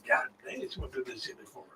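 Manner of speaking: hushed, voiceless whisper; acted secret aside under the breath; private thought. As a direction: whisper it as if thinking to yourself. Acted private thought during intimacy.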